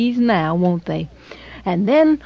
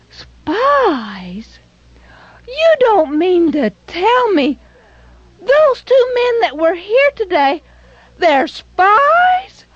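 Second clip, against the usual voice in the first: much higher, rising, louder shocked cry; feigned surprise; 11 semitones higher, 3 dB louder, slower than usual. Acted. Rahab fakes shock to the soldiers, high pitched disbelief. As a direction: play wide-eyed disbelief to fool them. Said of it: "And she acted so surprised".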